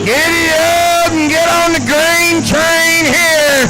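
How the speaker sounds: rally the crowd as the truck rolls out; big drawn-out rodeo-style holler; hype call. Acted. Revs up crowd for the pull.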